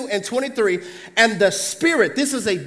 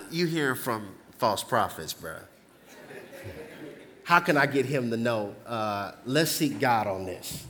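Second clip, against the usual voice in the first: much softer and lower, half laughing; hushed aside; 12 semitones lower, 10 dB quieter than usual. Retold or imitated. Preacher imagining Jehoshaphat muttering to himself. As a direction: murmur it like a private aside.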